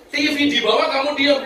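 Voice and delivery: high-pitched incredulous scolding; acted outraged husband; indignant husband. Husband scolds wife over theft.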